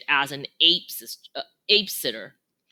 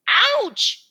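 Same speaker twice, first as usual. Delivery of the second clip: sharp high yelp of pain; a sudden squeal, much higher; 5 dB louder than usual. A narrator's reading. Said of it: "she yelps".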